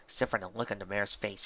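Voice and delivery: ordinary delivery, nothing marked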